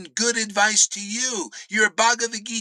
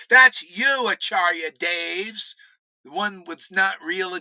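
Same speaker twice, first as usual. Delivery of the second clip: usual speaking voice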